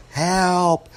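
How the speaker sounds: high-pitched scream for help. A narrator's reading. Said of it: "she's screaming".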